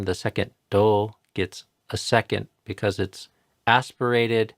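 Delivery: normal talking voice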